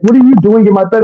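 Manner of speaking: high, startled mock yell at the intruder; alarmed outburst. Demanding why the naked woman is in his bed, high and shocked.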